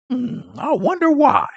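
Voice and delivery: sarcastic wonder. Real, not acted. Sarcastic rhetorical aside.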